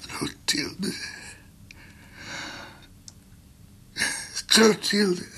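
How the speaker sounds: calling out. Acted. Dying man calls for Clotilde.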